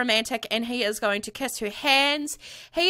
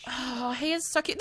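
ordinary delivery

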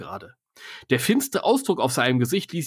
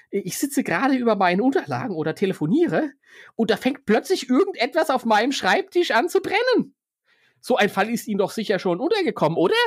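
higher, fussy businessman; broker voice; 8 semitones higher than usual. Acted. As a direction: insist it's strange.

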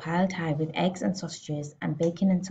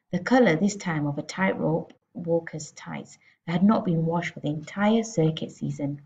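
usual speaking voice

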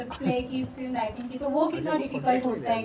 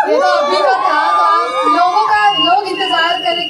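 excited teasing; 6 semitones higher, 11 dB louder than usual. Real, not acted. Teasing question about bride look.

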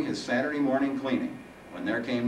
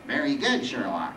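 dry mock praise, falling away; sarcastic; 3 semitones higher than usual. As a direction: congratulate him with a tinge of sarcasm. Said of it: "Sarcasm now tinged the Reaper's voice".

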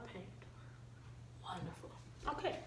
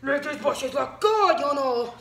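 put-on loud, slow pinched kid voice; pinched Emmett voice; 5 semitones higher, 14 dB louder, slower than usual. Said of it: "That's my impression of a kid I know".

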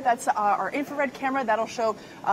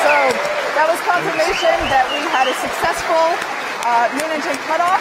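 louder, higher, thrilled announcing; excited; 4 semitones higher, 6 dB louder than usual. Real, not acted. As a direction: cheer the milestone loudly. Host cheers engine cutoff milestone.

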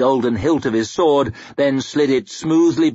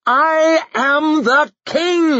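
high, slow, loud proclamation; furious king silencing the room; regal bellow; 5 dB louder, much slower than usual. A narrator's reading. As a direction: bellow it across the chamber. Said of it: "he bellowed at the suddenly silent chamber".

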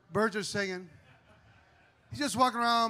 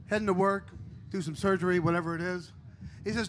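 normal talking voice

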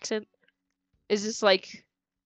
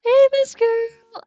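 high, loud squeal of delight; gasping, much higher and louder hello; excited greeting; 14 semitones higher, 11 dB louder, much slower than usual. Real, not acted. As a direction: squeal hello to a cute villager.

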